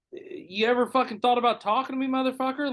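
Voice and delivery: high-pitched retold indignation; acted-out exasperated demand; indignant retort. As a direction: challenge him, voice pushed up high. Acting out indignant retort to boss.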